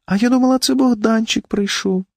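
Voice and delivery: high, slowing feeble invalid; weak wife's voice. A narrator's reading. Wife in bed expected Bohdanchyk, weak plaintive voice. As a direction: speak up high, drawing out the end. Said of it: "сказала вона слабким голосом".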